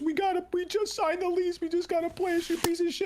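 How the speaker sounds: very high mock wailing rant; imitated screaming, crying. Neighbor berating boyfriend over the lease, mock crying high. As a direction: imitate her hysterics in a squealed high pitch. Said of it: "She's screaming, crying".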